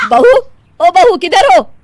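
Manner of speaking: loud, high-pitched summons; calling out. Acted. Mother-in-law calls for daughter-in-law around the house, calling loudly. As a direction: shout to find someone in the house.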